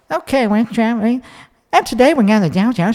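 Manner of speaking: mock vlog girl talk, high and quick; vocal fry vlogger voice. Shown as a demonstration. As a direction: lightly imitate a bland travel vlogger. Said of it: "give you an example of the most banal accent".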